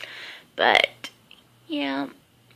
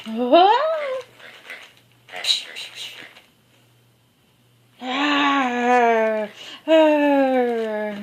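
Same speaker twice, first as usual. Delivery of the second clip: pet talk; 2 semitones higher, much slower than usual. Real, not acted. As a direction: coo at the puppy over her toy. Talking to the dog with toy.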